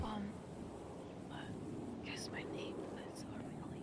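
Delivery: hushed unvoiced whisper; whispering on the phone. Real, not acted. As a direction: whisper so the walls won't hear. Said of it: "Why are you whispering?".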